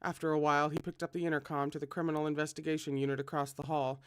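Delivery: ordinary delivery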